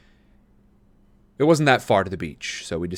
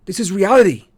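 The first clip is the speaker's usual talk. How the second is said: lightly voiced sly boy; 10 semitones higher, 10 dB louder than usual. A narrator's reading. Marco voiced in story.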